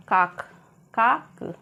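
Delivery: काक said slowly, both a's long and open; careful pronunciation. Shown as a demonstration. Teacher modelling काक for students, slow and clear. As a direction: spell out काक clearly for learners. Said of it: "pronunciation जो है वो सही से सुनिएगा".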